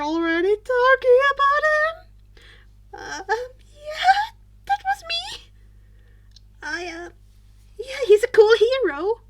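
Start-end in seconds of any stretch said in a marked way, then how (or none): 2.9-9.2 embarrassed mumble. Acted. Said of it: "Izuku muttered".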